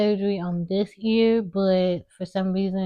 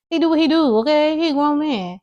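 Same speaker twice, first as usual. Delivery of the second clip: sassy; 9 semitones higher, 8 dB louder than usual. Real, not acted. Defending dad's dating, sassy.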